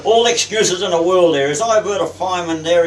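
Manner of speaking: captain berating a seaman, loud; angry captain. Retold or imitated. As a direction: retell a loud dressing-down. Captain berates young seaman for excuse.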